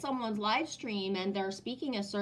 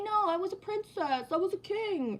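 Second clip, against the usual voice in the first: mock grandiose royal; 9 semitones higher than usual. Mocking people's grand past lives.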